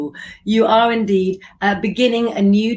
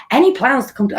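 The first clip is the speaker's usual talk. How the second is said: half-hearted stab at a Scottish accent; abandoned try at a Scottish accent; Scottish accent attempt; 6 semitones higher, 4 dB louder, faster than usual. Shown as a demonstration. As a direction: start it in a Scottish accent, then give up. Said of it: "I can't do a Sc-, uh, Scottish accent".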